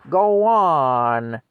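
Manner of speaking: loud, drawn-out roar imitating a mad supervisor; imitated angry roar. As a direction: roar it right into someone's face. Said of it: "pushing me around and roaring in my face".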